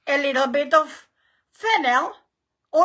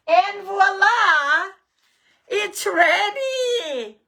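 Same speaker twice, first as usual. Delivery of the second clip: excited; 7 semitones higher, much slower than usual. Real, not acted. Big reveal of baked pie.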